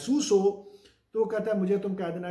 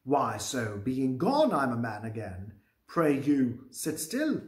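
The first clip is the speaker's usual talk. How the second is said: slow, low Shakespeare read-aloud; measured, low-pitched recitation; play reading; 5 semitones lower, much slower than usual. Acted. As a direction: read the verse out low and deliberately. Reads Macbeth's lines.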